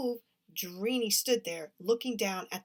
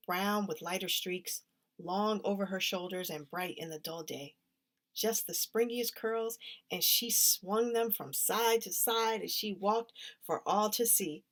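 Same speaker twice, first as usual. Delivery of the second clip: plain reading voice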